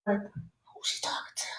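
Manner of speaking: whispered aside, slow and hushed; sassy whisper. Pretend. Caller imagining a rude bill collector, sassy whisper. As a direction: breathe it like a shocked aside. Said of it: "and you can be like".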